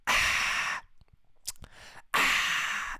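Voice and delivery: short whispered ah after a sip; Susie gasp. Shown as a demonstration. Mocking his own habit, a whispered sip-gasp ah. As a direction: exhale a soft whispered ah, twice. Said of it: "I just did the fucking Susie from Curb Your Enthusiasm".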